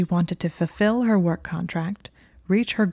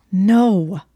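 through gritted teeth; 2 semitones higher, 8 dB louder than usual. Acted. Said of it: "said through her teeth".

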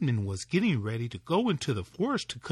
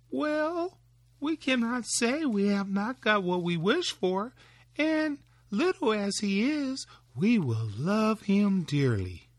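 higher, gentle woman's voice; wife's voice; 7 semitones higher than usual. Acted. Wife answering her husband by the fire, higher and gentle.

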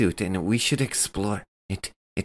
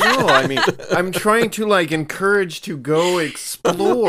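laughing through the words; giggling while talking; 10 semitones higher, 8 dB louder than usual. Real, not acted.

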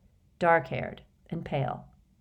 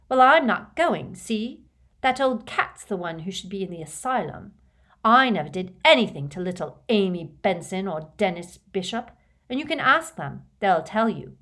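loud defiant outburst; defiant shout; 2 semitones higher, 6 dB louder than usual. Acted. Orphan boy refusing to go, defiant shout. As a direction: refuse him loudly.

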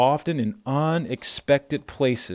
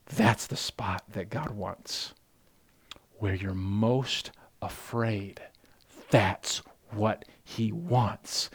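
quieter, slow and close, as if confiding; soft and intimate; 3 semitones lower, 6 dB quieter, slower than usual. Acted. Pastor softly confiding to his congregation about fear. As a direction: draw the congregation in gently.

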